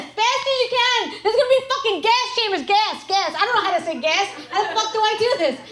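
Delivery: high, loud frantic yelling; mock panic. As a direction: scream the warning in a panic. Panicked warning to family.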